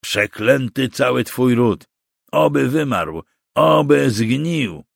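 hoarse, bitter cursing; rasped curse. A narrator's reading. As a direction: spit out a curse as a bound captive. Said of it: "wycharczał Kruk".

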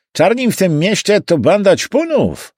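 high-pitched cabbie voice; opinionated cabbie holding forth; cab driver. Acted. Driver grumbling to his passenger, high cabbie voice.

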